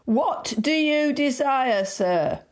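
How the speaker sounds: high, slow, loud question; asked loudly. A narrator's reading. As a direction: call it out slowly to a deaf man. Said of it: "asked very loudly".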